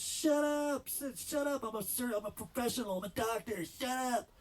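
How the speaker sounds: yelled bro commands; bossy shouting surgeon. Retold or imitated. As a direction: shout him down and boss him around. Surgeon telling him to shut up.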